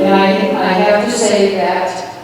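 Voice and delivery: normal talking voice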